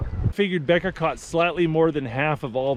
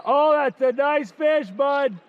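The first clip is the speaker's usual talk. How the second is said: excited; 8 semitones higher, slower than usual. Real, not acted. Fishing trip, excited call.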